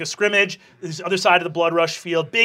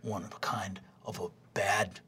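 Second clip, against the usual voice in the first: hushed, breathy mocking jab; Rog's snide whisper; 11 semitones lower, 11 dB quieter than usual. Jock whispering an insult at the new kid. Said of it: "Rog says".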